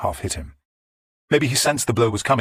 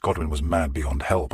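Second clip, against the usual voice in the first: ordinary delivery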